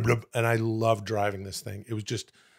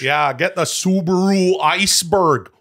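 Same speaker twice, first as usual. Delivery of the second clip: slow, higher, louder put-on Canadian voice; mock Canadian; 7 semitones higher, 7 dB louder than usual. Joking about Canadian car names in a hammed-up Canadian accent. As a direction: lay on a hokey Canadian accent, drawn out.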